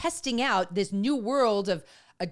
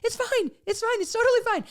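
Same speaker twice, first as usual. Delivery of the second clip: higher, comforting reassurance; soothing son; 4 dB louder than usual. Retold or imitated. Son hugging his crying mom, reassuring. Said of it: "He came over and hugged me and he's like".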